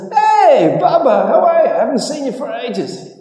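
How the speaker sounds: very high, loud greeting; Trump hailing Bubba. Acted. Donald Trump calling to Bubba from the limousine, very high and loud. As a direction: holler it out the limo window, chummy. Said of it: "sticks his head out ... goes".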